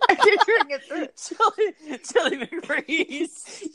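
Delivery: high squeaky laughter through words; cracking up at the silly name; laughing. Real, not acted. Laughing hard.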